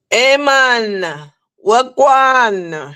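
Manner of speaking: hammed-up fake Jamaican patois; mock Jamaican accent. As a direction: tease it in a faking Jamaican accent. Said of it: "[name] loves to pretend like a faking Jamaican".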